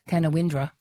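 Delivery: Canawindra as "kana-WIN-dra"; common mispronunciation. Retold or imitated. Host quotes people getting it wrong, Canawindra as "kana-WIN-dra". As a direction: sound out Canawindra as spelt, with "win" in it. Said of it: "people say Ca".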